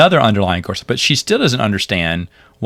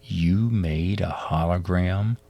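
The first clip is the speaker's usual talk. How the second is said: slow, indignant disbelief, a little softer; offended; 3 semitones lower, 3 dB quieter, slower than usual. Retold or imitated. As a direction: Zach aghast at his friend's hologram, offended slow question. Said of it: "Zach is just so offended".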